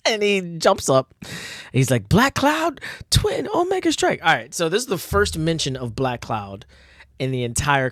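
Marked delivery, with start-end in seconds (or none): 2.1-4.2 high, hammed-up battle cry; attack call. Hero announcing his special attack, hammed-up high cry. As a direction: call the move out like an anime hero.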